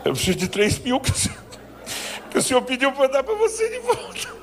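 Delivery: high, laughing, sheepish plea; embarrassed pastor's mock plea to God; awkward mock prayer. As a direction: pray as if you feel ridiculous. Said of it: "Eu me sentia ridículo".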